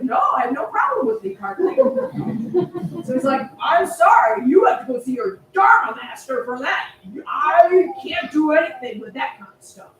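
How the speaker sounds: loud, booming refusal; shouting judge. Acted. Judge refusing to change the Dharma name, loud shout. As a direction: bellow it from the bench.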